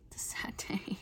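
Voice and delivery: hushed whisper mourning the bugs; whispered lament. Pretend. Vlogger mock-mourning her bug troubles in a whisper. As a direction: sigh it out in a whisper.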